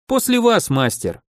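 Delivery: high lilting courtesy; a polite, lifted after-you; deferential. Acted. Pupil lets his master step into the portal first, deferential.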